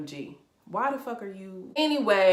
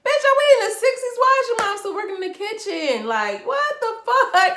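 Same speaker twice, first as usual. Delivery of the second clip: outraged disbelief; 9 dB louder than usual. Retold or imitated. Reacting to Amara's mom in the kitchen, loud outraged disbelief. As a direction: shout it like you can't believe the sixties are back. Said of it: "I'm like".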